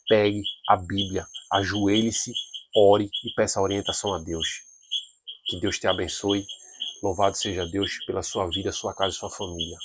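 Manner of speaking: soft, low pastoral sign-off; gentle closing blessing. Real, not acted. Preacher blessing the viewers as the study ends, soft and low.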